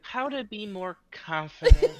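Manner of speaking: high, slow, laughing nerd voice; mock nerdy voice. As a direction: squeak it out like a nerd. Mocking the nerdy narrator.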